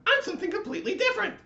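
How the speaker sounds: very high, loud, fast wail; frantic crying latke. Acted. Latke insisting to the lights, fast high wail. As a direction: squeal it out in a rush. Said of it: "cried the latke".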